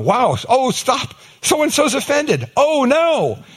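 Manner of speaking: high, loud mock panic; mock alarm. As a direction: ham up the alarm over the offended. Mocking offended culture.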